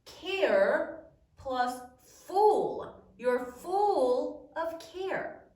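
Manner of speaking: "care plus full" spoken slowly and deliberately; slow explaining. Shown as a demonstration. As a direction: lay out the word parts slowly. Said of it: "did you know that careful comes from".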